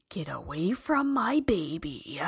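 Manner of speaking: hissed whisper, protective mother; fuming whisper. Retold or imitated. Owner silently warning the stranger away, fuming whisper. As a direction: whisper it like a mama bear guarding her cub. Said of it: "but in my head, fuming".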